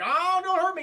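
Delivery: high, loud whimpering beg; mock terrified plea. Stranded man begging three gang members, high and loud. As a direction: beg them not to hurt you.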